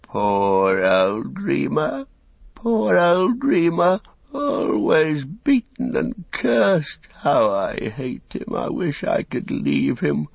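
high, slow, self-pitying wail; whimpering. A narrator's reading. Beaten servant complains as he slouches past, whimpering. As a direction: climb into a drawn-out piteous whine.

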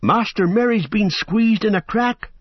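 high urgent call; urgent cry. Acted. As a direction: cry out in alarm. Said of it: "cried Sam".

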